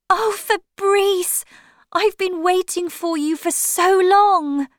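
overjoyed to hear his voice at last; happy. Acted. Linda answers Fabrice's call, joyful and high. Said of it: "Linda said, happily".

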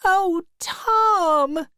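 high, drawn-out upset wail; tearful girl. A narrator's reading. Becky learning of Tom's old love, tearful high voice. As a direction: cry out high and hurt. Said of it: "she began to cry".

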